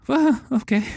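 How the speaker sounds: high, jittery mock-nervous voice; nervous people. Shown as a demonstration. Game master demonstrating his fun accents, high nervous voice. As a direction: play it anxious and high. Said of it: "the nervous people".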